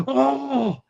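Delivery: surprised yelp. Real, not acted. Sudden reaction to a play.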